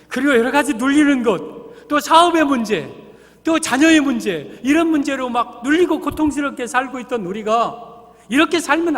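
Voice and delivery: impassioned sermon climax; fervent preaching. Acted. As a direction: press the congregation with each burden. Preaching builds to full force.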